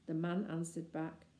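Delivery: usual speaking voice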